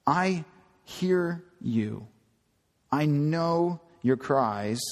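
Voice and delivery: slow, tender reassurance; the Lord gently comforting Hagar. Retold or imitated.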